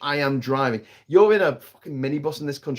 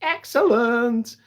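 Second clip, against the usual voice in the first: drawn-out excellent; 10 semitones higher, much slower than usual. Pretend. Drawn-out appeal for likes.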